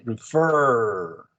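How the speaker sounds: "refer" very slow, FER held long; stress on second syllable. Shown as a demonstration. Teacher explains doubling the R, "refer" with drawn-out FER. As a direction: hang on the last syllable of "refer". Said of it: "stress in the second syllable".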